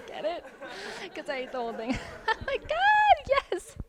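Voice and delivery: forced high fake laughter. Server fake-laughing at a customer's joke, high and forced. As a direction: laugh along to please the customer. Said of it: "Fake laughing".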